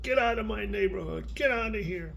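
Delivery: high mock squirrel scolding; squirrels yelling. Grandparent voicing squirrels chasing an eagle, high squeaky scolding.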